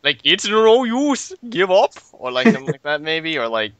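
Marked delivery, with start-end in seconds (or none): 0.2-1.9 higher, very slow and louder hammed-up Scottish Silver; bad Scottish accent. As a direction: overdo the Scottish accent on purpose. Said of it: "It sounds horrible".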